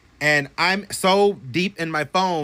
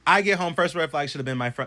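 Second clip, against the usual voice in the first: ordinary talk, nothing marked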